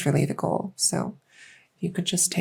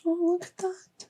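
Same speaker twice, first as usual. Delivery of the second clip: high-pitched, tender fawning; delighted cooing; 5 semitones higher than usual. Acted. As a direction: coo over an adorable surprise find. Delighted discovery during role-play exam.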